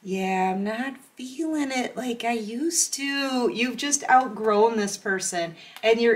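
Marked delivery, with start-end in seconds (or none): 0.0-3.5 quiet, drawn-out letdown; soft, slow brush-off. Retold or imitated. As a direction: let them down softly, slowly. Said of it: "you're just looking at 'em like".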